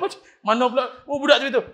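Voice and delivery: ordinary delivery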